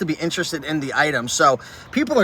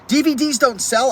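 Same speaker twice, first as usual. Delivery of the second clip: quoting critics in a higher, scoffing voice; higher sarcastic parroting; mocking quote; 8 semitones higher than usual. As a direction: throw their words back with a sneer.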